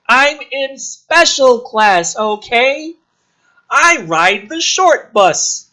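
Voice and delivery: high, loud, slow put-on whine; a put-upon nerd blurting back; [name] snaps back at Casey in a high, slow, put-on whine; whiny defensive kid.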